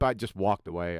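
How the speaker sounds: usual speaking voice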